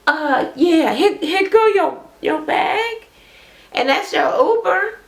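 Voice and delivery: high, sugary mock politeness; fake-sweet girlfriend. Girlfriend cheerfully handing her boyfriend his bag, high and fake-sweet. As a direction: sweetly show him the door.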